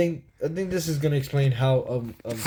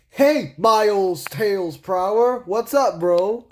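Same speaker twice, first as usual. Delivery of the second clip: drawn-out, higher cartoon greeting; Sonic; 5 semitones higher, 3 dB louder, slower than usual. Sonic meeting Tails, stretched hammed-up hello. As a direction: hail a buddy big and slow. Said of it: "Sonic goes like".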